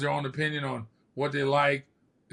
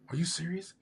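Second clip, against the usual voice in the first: hushed disbelieving whisper; 4 semitones higher, 6 dB quieter than usual. Retold or imitated. Teen at Robbie's door whispering to his friend. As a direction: whisper it so nobody inside hears.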